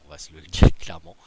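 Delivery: blurts it out loud and fast, breaking into a laugh. Real, not acted. Streamer laughing to viewers about the overpowered player. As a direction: laugh mid-sentence while conceding the point. Laughing while admitting the obvious.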